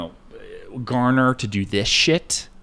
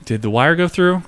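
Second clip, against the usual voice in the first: usual speaking voice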